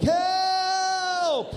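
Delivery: mock-terrified scream of help; shouted cry for help. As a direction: shriek it from up in the air. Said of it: "And I'm like".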